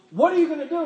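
high-pitched mock yell at mom; retold yelling. Daughter yelling at her mom over the boys, mock frantic yell. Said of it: "yelling at her mom".

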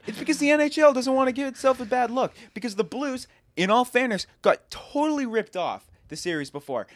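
indignant rant. Real, not acted. Hockey fan disputing penalty calls with his co-host, indignant rant. Angry fan ranting about referees.

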